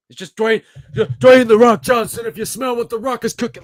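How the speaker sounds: high, loud shouting in mock wrestling-promo style; exasperated rant. Real, not acted. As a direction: yell it like a fed-up fan. Ranting about The Rock.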